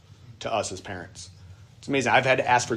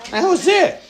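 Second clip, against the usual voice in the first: exclaiming; 6 dB louder than usual. Real, not acted. Recalling stick-and-rock childhood.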